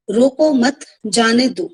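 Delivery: slowly, "रोको मत" as one group, pause, "जाने दो"; pause after मत. Shown as a demonstration. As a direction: put a spoken comma after मत. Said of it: "मत के बाद कॉमा लगाइए".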